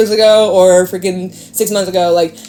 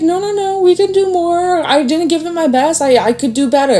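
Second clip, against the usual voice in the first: much higher, pleading voice insisting on trying more; mock eager client; 8 semitones higher than usual. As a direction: play the stubborn client who won't give up. Client voiced wanting to try more.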